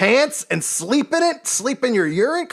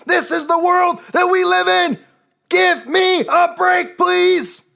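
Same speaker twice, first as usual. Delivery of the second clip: exasperated shout; 10 semitones higher, slower than usual. Real, not acted. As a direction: throw up your hands and yell it slowly. Exasperated outburst at the world.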